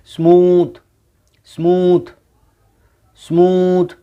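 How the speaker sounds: स्मूथ with the double O stretched into a long oo. Shown as a demonstration. Teacher to class, stretching the long oo in स्मूथ. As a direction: draw out the oo in स्मूथ slowly. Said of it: "double ओ को हम लंबा खींचेंगे".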